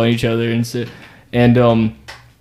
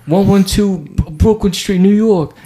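higher, put-on Brooklyn tough reciting an address; mocking the two guys trading addresses, high put-on voice; 8 semitones higher, slower than usual. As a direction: puff up and bark out the address like a street guy. Guys shouting addresses, imitated.

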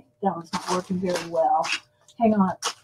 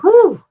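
high, loud relieved exhale; relieved whew; 12 semitones higher, 13 dB louder than usual. Retold or imitated. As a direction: blow out a big relieved whew. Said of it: "Rachel went".

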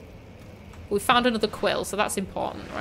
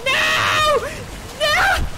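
exasperated shout; 13 semitones higher than usual. Real, not acted. Player losing to the monster, high exasperated shout. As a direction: wail at the unfair game. Failing in game, despair.

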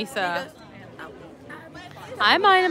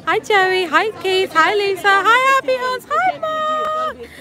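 building excited greetings; 9 semitones higher than usual. Real, not acted. Greeting viewers, rising excitement.

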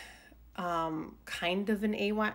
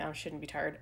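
ordinary talk

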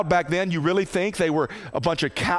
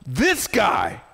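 raised, pressing, incredulous governor; dumbfounded Pilate; 6 semitones higher than usual. Acted. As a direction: plead with the crowd in disbelief, voice climbing. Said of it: "He's saying".

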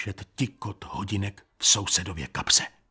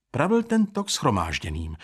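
ordinary delivery, nothing marked

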